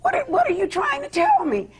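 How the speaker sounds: very high, urgent questioning; high-pitched retold disbelief; retold alarm. Retelling alarmed reply to sobbing daughter.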